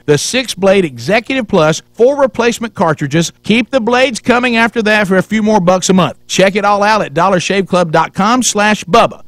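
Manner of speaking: energetic radio ad delivery; ad read. Acted. Host reads a sponsor ad.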